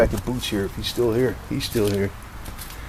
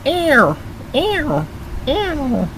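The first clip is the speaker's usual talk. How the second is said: much higher squeaky ow cries; mock yelps; much slower than usual. Man squeaking playful ow cries in the garage. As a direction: yelp it like a hurt kitten.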